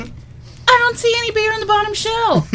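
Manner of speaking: very high mock holler; hammed-up high-pitched complaint; husband yelling back. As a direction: shout back at the wife, exasperated. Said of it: "he's yelling at his wife".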